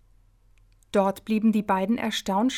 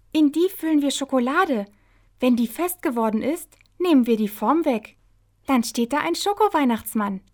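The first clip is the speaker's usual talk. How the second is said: louder, excited character voice; eager Hopsi; 4 semitones higher, 5 dB louder than usual. Acted. Hopsi explains her chocolate mould plan, eager and excited. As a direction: explain the plan as an eager little story heroine.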